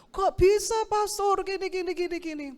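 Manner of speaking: high, pleading excuses; whiny young excuse. Retold or imitated. Volunteer excusing himself to the pastor, high whiny protest. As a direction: brush it off like a sulky youth.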